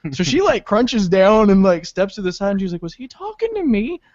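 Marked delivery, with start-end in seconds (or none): 2.8-4.0 high, soft imitation of a sweet lady; timid, high-pitched bewildered question; nicest lady. Nice lady asking after a stranger yells at her, sweetly bewildered. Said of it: "she was the nicest lady".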